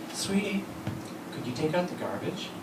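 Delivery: soft, sweetly polite request; sweet wife. Retold or imitated. Wife asking husband for a chore, soft and sweet. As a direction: ask it gently, like a sugary favour. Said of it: "my wife says to me".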